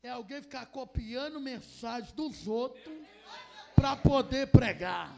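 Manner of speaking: slow shouted rebuke; loud rebuke. Real, not acted. Preacher scolding copied sermons, slow and shouting. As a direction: drag each word out at full voice.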